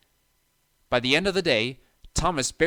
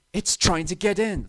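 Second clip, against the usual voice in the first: frightened; 5 semitones higher, 5 dB louder than usual. A narrator's reading. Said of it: "thought Thomas".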